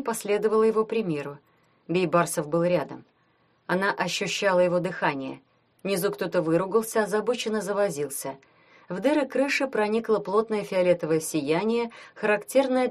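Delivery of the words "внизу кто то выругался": plain reading voice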